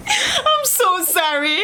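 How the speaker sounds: ordinary talk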